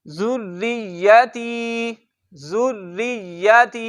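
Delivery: जुर्रियती slow, with the rr held and the r thin. Shown as a demonstration. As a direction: stretch the rr in जुर्रियती, long ता. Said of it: "इसको पढ़ना है".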